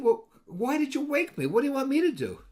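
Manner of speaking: high, put-upon whine; exasperated protest. Retold or imitated. Husband protests to wife who woke him, high and exasperated.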